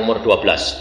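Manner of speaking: ordinary talk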